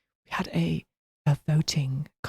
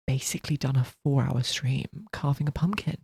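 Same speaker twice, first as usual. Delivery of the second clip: ordinary talk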